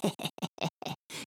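soft, breathy witchy cackle; hushed mock-evil laugh; spooky cackle. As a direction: cackle teasingly to scare. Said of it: "She cackled".

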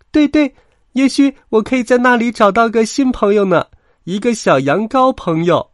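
very high, loud, happy lamb; cheered-up lamb. A narrator's reading. Little lamb hopes for a new friend, happy squeal. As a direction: pipe up hopefully as a small lamb. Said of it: "又变得开心起来".

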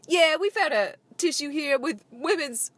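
playful gruff cop impression that breaks high at the end. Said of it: "Ice-T's all walking up in there like".

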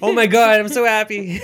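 hammed-up happy gush; mock overjoyed. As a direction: squeal it out in delight. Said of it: "And you're like".

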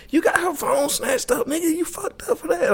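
lightly voiced taunting classmate, high and soft; mock classmate. Retelling a classmate taunting him in class, high mock tease. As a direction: needle him for getting her caught. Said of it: "So Tay's like".

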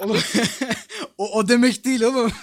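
words bursting through laughter; can barely talk for laughing. Real, not acted. Laughing at a silly answer. (gülerek, kahkahalar arasında)

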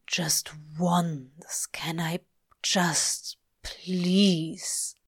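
hushed, breathless whisper; whispered plea. Acted. Crowley begging the angel just once, in a whisper. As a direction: beg in a whisper.

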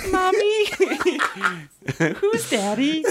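put-on very high whiny little kid; childlike voice. Podcast host mocks a child asking for mommy, squeaky toddler voice.

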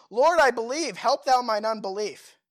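loud, high impassioned plea, read aloud; crying out in prayer. A narrator's reading. Preacher voicing the desperate father's plea to Jesus, crying out. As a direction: cry it out from scripture at full force. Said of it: "cried out and said with tears".

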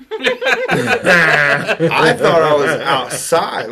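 slow words broken by laughter; laughing. Real, not acted. Telling friends the pee story, laughing hard. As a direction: laugh through the confession.

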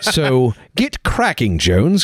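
slow, pompous British imperial command; mock British accent. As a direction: order an underling about in hammed-up British. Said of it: "with the most British accent you can".

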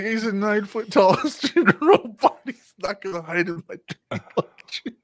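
high, laughing whine; mock whiny protest. Teasing the friend about his Arnold fear, high laughing whine.

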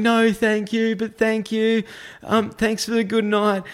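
high, slow, over-polite refusal; a nervous, high-pitched polite goodbye; panicky politeness. Retold or imitated. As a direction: stay courteous while fleeing in a panic. Polite refusal while fleeing.

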